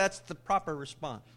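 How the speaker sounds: usual speaking voice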